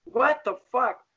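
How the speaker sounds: high, drawn-out indignant outburst; outraged. Retold or imitated. Father catching foster mother hurting his daughter, outraged. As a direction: raise the pitch and stretch it in shock.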